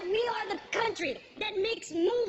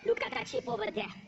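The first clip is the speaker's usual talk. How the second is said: normal talking voice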